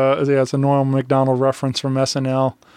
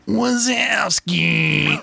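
put-on very slow, higher, dragged-out old clerk; Monsters Inc lady voice; 6 semitones higher, much slower than usual. Repeating the movie line at home, slow higher drawl. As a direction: drag the name out like a bored old clerk. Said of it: "doing that lady's voice".

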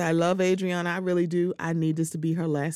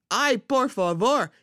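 ay por favor; 6 semitones higher, 3 dB louder than usual. Real, not acted. Exasperated aside in Spanish.

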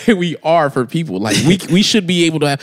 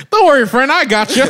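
friendly coworker voice; 9 semitones higher, 3 dB louder than usual. Retold or imitated. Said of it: "You know what Tom gonna do?".

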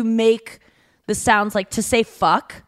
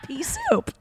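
high laughing quip; 5 dB quieter than usual. Real, not acted. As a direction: laugh out the punchline. Joking pea soup.

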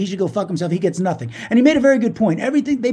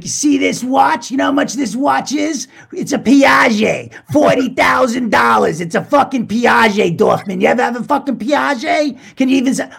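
mock the flashy braggart, shouting his brag; bragging rich guy; 4 semitones higher, 4 dB louder than usual. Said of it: "That's that guy".

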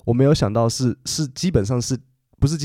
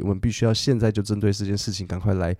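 ordinary talk, nothing marked

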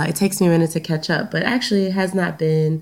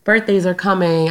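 ordinary talk, nothing marked

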